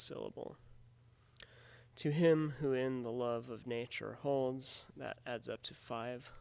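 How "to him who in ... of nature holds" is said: slow line stressing "him", "in", "love", "na-", "holds"; marked iambic stresses. Shown as a demonstration. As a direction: beat out the five stresses of the line. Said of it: "counting the stressed syllables".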